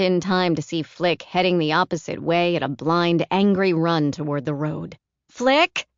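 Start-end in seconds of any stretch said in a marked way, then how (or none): 5.3-5.9 put-on high, loud call after a running child. A narrator's reading. Caroline calling after running Flick, loud call. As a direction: call his name across the lot. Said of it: "she called".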